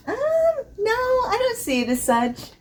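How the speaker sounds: high, mock-incredulous no; doubtful retort. Reviewer mock-rejecting the skin scent label, incredulous. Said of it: "and I'm like".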